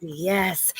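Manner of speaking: ordinary delivery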